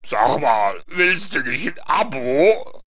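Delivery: loud, slow put-on Reaper voice; drawn-out, booming question; voice of Death. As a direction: pitch it like Death making a sales offer. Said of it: "der Tod sagt dann plötzlich so".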